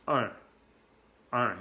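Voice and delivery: "un" as a French nasal vowel, n not sounded; short French "un", a nasal uh; slightly nasal vowel. Shown as a demonstration. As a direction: let "un" resonate in the nose. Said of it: "un poco nasal".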